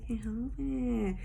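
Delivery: soft, playful whisper; whispered excuse. Real, not acted. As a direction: whisper a helpless little excuse.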